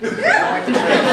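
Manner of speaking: mock squeak cracking up; laughing. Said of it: "I'm like".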